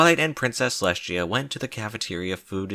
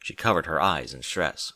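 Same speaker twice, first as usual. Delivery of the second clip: plain reading voice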